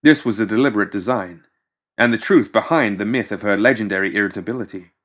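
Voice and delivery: usual speaking voice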